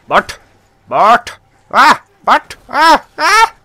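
real panic, screaming high and loud; panicked screams. Real, not acted. Gamer attacked by enemies, panicked screams.

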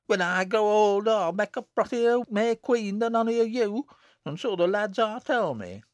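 put-on high, loud, boastful child in dialect; indignant cry. A narrator's reading. Jennet answering Nancy's jeer, indignant. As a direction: retort hotly, pitched well up. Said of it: "cried Jennet, reddening".

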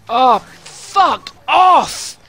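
drawn-out yell. Real, not acted. Player cut off by a car, drawn-out yell. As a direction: stretch the curse out at the screen. Furious at game.

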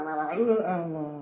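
usual speaking voice

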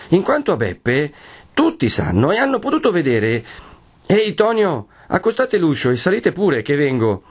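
voce più acuta, concitata e di fretta; Perpetua appassionata. Acted. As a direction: Perpetua che chiama Tonio con voce alta. (Perpetua che grida a Tonio mentre racconta, più acuta)

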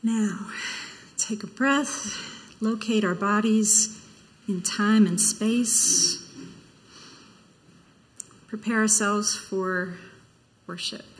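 slow and soft, settle the room; gentle call to worship. Acted. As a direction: breathe with them, unhurried. Invites the congregation into stillness.

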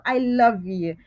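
ordinary delivery

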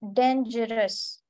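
डेंजरस slowly, each syllable apart; syllables spelled out. Shown as a demonstration. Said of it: "three syllables हैं इसमें". (डेंजरस को धीरे धीरे syllable में बोलिए)

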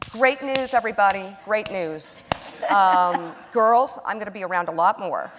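bright, loud mock-happy news to the kids; selling bad news as great news; fake cheerful announcement. Mother spinning her firing to daughters, loud fake cheer. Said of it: "I tried to sort of spin it".

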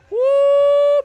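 high, loud whoop of excitement; excited woo. Real, not acted. Gamer whooping at a near overtime goal, high whoop. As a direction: let out a sudden high whoop.

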